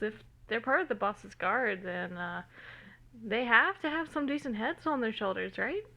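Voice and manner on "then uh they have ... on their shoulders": ordinary delivery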